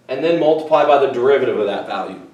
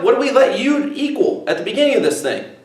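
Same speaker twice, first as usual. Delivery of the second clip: usual speaking voice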